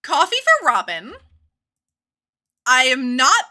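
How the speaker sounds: loud, laughing self-defence; loud protest. Real, not acted. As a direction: insist it loudly: you mean no harm. Protesting chat's murder joke.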